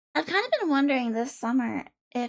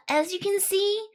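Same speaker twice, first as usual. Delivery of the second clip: normal talking voice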